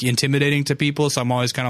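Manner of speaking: ordinary talk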